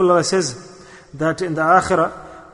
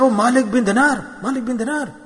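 high, astonished cry of the saint's name; suddenly realizing who he turned away; surprised recognition; 8 semitones higher than usual. Acted. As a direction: gasp out the name in awe. Man realizes who the saint was.

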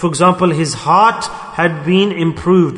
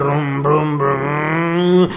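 car engine noise; 2 semitones lower, much slower than usual. Pretend.

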